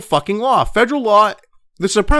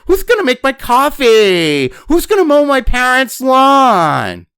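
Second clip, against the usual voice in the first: whiny mock shout, pitched much higher and louder, slowly; mocking spoiled kids; 7 semitones higher, 5 dB louder, slower than usual. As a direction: parody entitled college kids yelling. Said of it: "we're like".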